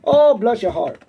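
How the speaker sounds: hammed-up, amateur Italian accent; animated mock Italian accent, rising and falling. Shown as a demonstration. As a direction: ham it up as a cartoon Italian. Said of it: "there's that Italian".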